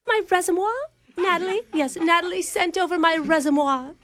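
prim upper-class English voice; posh British accent. Acted. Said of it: "I'm just a regular Margaret Thatcher".

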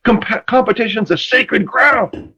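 high, intense and emphatic; the teller pitches up to voice Ronnie's fervour; passionate Ronnie. Retold or imitated. Quoting Ronnie Lott to Dan, high and intense. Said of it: "He goes".

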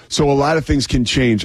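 ordinary delivery

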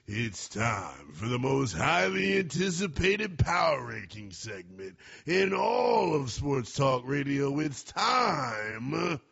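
slow, drawn-out hype announcement; announcer intro. Acted. Host hyping his segment to listeners, announcer style. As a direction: build it up like a big reveal.

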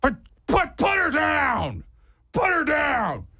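put-on high slow angry old man's shout; old man yelling. Owner yells at narrator to drop his dog, high slow shout. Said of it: "He goes".

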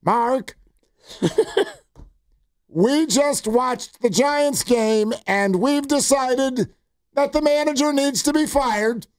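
put-on high, slow drawn-out old-mom voice; mock-solemn elderly mother, high and measured; impersonated elderly mom. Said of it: "doing her voice".

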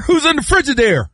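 high-pitched mock challenge; imitated suspicious dad. Dad guarding the Frigidaire, high mock challenge. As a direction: call it out high from another room. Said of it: "Do your people call it Frigidaire?".

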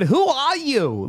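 drawn-out, high mock Jersey "who are you"; Jersey accent. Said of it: "I love a good".

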